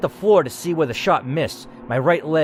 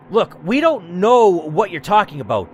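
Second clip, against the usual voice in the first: ordinary talk, nothing marked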